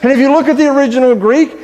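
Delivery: ordinary delivery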